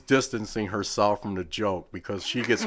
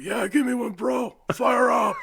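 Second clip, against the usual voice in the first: higher, louder, hyped-up shout; Jamie Cox impression; 4 dB louder than usual. Mocking the wrestler to his face while drunk, hyped shout. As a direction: yell it back in his wrestler voice. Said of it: "I will start talking to him like him".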